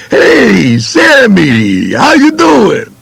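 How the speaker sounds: high, drawn-out friendly hello; big smiley greeting. Retold or imitated. Boss greeting Sammy with a big smile, high and hearty. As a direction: greet him like an old pal, grinning. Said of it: "he'd come over with a big smile and say".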